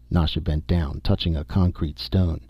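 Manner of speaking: usual speaking voice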